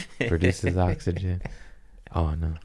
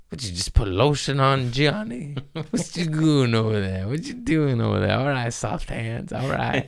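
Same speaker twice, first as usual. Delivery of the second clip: higher-pitched mock-nosy ribbing; playful teasing; 7 semitones higher than usual. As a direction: tease him in a raised, put-on voice. Retold teasing of the drive's owner.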